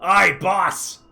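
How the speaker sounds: loud, eager henchman calling out; a mob goon's eager report; loud mafia familiar. Acted. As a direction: shout it up like a loyal thug. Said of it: "telepathically sends back to you".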